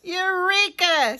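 high, loud exclamation of discovery; triumphant cry. Acted. Goat's cry of success.

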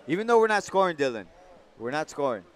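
usual speaking voice

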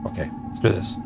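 whispered pep talk; hushed under the breath. Real, not acted. Whispered hype-up.

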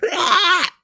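high imitated screech; mock screech. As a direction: let out a short shriek. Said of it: "like this screeching".

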